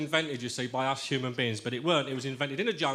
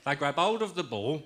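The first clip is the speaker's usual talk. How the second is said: sports commentator; 3 semitones higher, slower than usual. Acted. Football commentary verse delivery.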